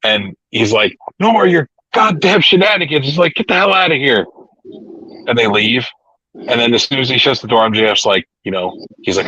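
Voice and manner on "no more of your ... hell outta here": high-pitched retold telling-off; hammed-up angry boss, pitched up; mock yelling. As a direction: imitate a boss cussing out staff, without real volume. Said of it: "yelling and cussing at him".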